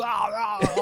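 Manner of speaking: high strained "aah" groans; Arnold Schwarzenegger. Retold or imitated. Whole crowd doing it, imitated action-hero groan. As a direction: imitate an action hero's drawn-out yell. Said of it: "knowing how to do Arnold Schwarzenegger".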